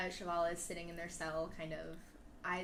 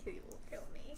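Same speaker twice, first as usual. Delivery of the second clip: whispered; 9 dB quieter, faster than usual. Real, not acted. GM fears Ben's wrath.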